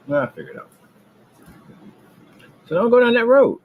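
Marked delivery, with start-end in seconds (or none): 2.7-3.6 sudden shout. Real, not acted. Sudden outburst warning rivals.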